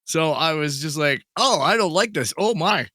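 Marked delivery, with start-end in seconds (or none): none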